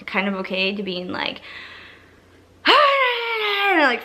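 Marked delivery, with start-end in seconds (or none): 2.7-4.0 high, wordless panicky wail; mock freak-out wail. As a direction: imitate someone freaking out in one long falling cry. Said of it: "freaking out, and then she started crying".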